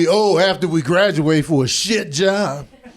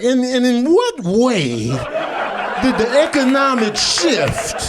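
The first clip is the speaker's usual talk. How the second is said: mock professor; 4 semitones higher than usual. Imitating college professor.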